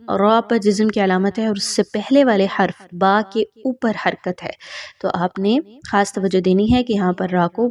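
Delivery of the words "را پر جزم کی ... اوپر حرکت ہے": ordinary delivery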